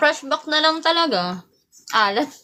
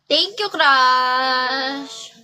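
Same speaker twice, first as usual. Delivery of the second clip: drawn-out; much slower than usual. Acted. Drawn-out thanks to viewer.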